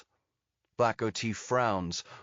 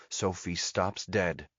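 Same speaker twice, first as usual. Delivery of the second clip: ordinary delivery, nothing marked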